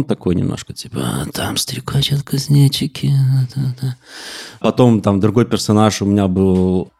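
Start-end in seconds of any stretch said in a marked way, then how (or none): 1.0-4.0 hint of a Gary Oldman villain; maniac officer voice. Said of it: "как Гарри Олдман в фильме «Леон»".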